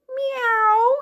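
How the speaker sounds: put-on a high cat meow for the kids. Shown as a demonstration. Teacher meows high for the children.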